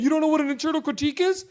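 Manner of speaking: high, fast condescending sneer; mock condescension. Mocking Andrew lecturing people, a high fast smug sneer. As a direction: sneer it like a smug know-it-all. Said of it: "go around saying".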